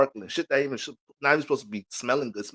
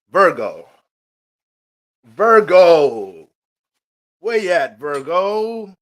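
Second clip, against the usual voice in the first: calling out; 4 semitones higher, 11 dB louder, much slower than usual. Real, not acted. Calling for next sign.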